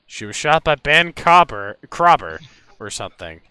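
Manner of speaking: ordinary talk, nothing marked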